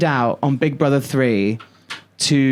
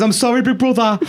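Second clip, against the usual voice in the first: put-on higher, laughing Brummy accent; 8 semitones higher than usual. Host imitating Alison apologising to Big Brother in Brummy accent. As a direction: laugh through an attempted Birmingham accent. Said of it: "I'm trying to do Brummy accent".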